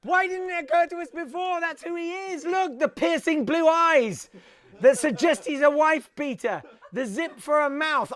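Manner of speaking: high, loud mock amazement; excited revelation. Host showing the audience an uncanny lookalike, excited. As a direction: crow over an uncanny discovery. Crowing over a lookalike discovery.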